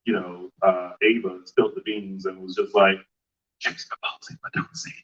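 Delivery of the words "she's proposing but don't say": hushed, imitated gossip whisper; quick conspiratorial whisper, acted out; secretive whisper. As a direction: leak a secret under your breath. Retells Ava spilling a secret.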